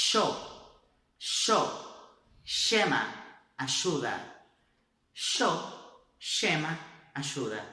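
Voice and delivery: llama as shema, ayuda as ashuda; y and ll both said as sh; Argentine sh sound. Shown as a demonstration. Teacher models Argentine y and ll, sh sound. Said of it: "Se fosse na Argentina".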